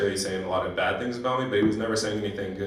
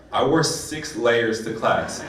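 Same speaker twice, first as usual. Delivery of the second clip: ordinary delivery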